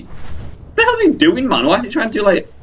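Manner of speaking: high-pitched baffled complaint; exasperated disbelief. Real, not acted. Gamer watching the Quetzal misbehave, exasperated disbelief. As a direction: squeal it in frustrated bewilderment.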